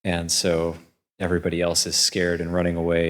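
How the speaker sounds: ordinary delivery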